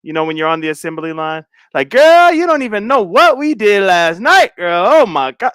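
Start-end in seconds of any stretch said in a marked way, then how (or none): 1.9-5.5 loud gossiping over machinery; mock loud factory-floor girl talk; loud coworker chatter. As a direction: shout the gossip like over equipment. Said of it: "do y'all have to talk like that".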